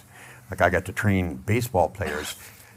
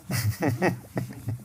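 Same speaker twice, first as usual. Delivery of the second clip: breathless high silent laugh; airy unvoiced chuckle; wheezy laugh; 9 semitones higher, 4 dB quieter than usual. Real, not acted. After joke.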